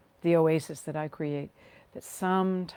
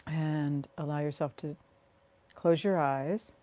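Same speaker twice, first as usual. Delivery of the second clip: guided meditation. Real, not acted. Leading listeners to rest and ground through their feet, guided meditation. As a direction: ease them into the body, phrase by unhurried phrase.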